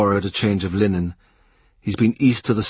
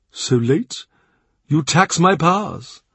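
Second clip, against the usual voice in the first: the Cardinal's offer; 8 semitones higher than usual. A narrator's reading. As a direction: ask like a generous prince. Cardinal jokes back.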